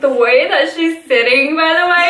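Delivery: high squealing laughter through the words; laughing. Real, not acted. Laughing as she tells about the lady's odd pose. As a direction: lose it describing the lady. Cracking up describing lady.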